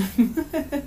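soft amused chuckle; short, fond giggle; mother's laugh. A narrator's reading. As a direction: laugh lightly at a child. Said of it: "laughing again".

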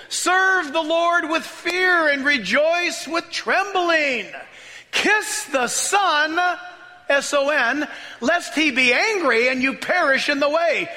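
high, slow, ringing preacher's reading; fervent scripture reading. Acted. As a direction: proclaim each phrase to the crowd. Preaching scripture at full force.

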